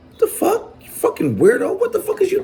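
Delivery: high, squeaky mock outburst; imitating his outburst. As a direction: act out a shrill what-the-fuck. Retelling his reaction to the man.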